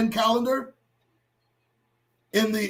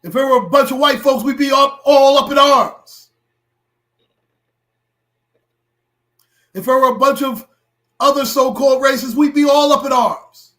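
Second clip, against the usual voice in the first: heated rant; 4 semitones higher, 6 dB louder than usual. Real, not acted. Ranting about community reaction.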